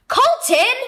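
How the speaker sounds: high, loud exclamation of a name; sharp call. Acted. Brother catching little brother in the mess, loud and high. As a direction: shout his name in alarm.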